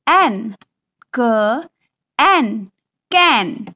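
क and एन apart, then कैन; sounding out. Shown as a demonstration. Teacher modeling how a child reads कैन. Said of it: "बच्चा पढ़ पाएगा क".

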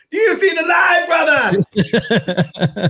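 high, hammed-up revival-tent holler; playful preacher shout. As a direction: shout it like a mock evangelist. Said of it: "what we always used to say to each other".

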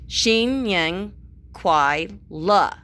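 "Xin Yang Kuai Le" spelled out very slowly, syllable by syllable; careful pronunciation. Shown as a demonstration. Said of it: "I spell it out so it's phonetic".

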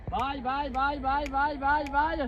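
high, soft sing-song calls; high bird call. Real, not acted. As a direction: coax the hawk down gently. Calling the hawk back.

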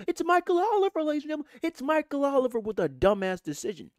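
high, loud mock introduction; mock announcer. Fan announcing the referee's VAR decision to viewers, mock grand announcer.

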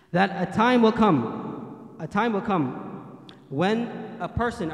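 ordinary delivery

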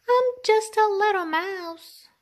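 high, loud little squeak; tiny mouse voice. Acted.

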